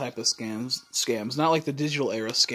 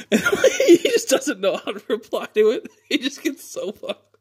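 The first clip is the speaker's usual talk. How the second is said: high, squeaky laughing speech; 10 semitones higher, 3 dB louder than usual. Real, not acted. Telling viewers how he was left speechless, laughing hard. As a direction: crack up as you tell it. Laughing while retelling the comeback.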